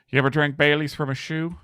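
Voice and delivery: high, put-on character voice; Old Gregg impression. Player quoting a comedy character, high put-on voice. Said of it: "old Greg".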